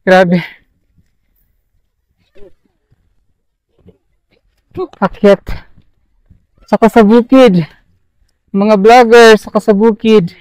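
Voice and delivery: breathless from the mountain climb; out of breath. Real, not acted. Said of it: "Hinihingal ako".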